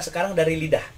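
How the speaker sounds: ordinary talk, nothing marked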